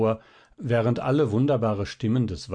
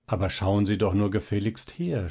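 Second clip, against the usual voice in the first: plain reading voice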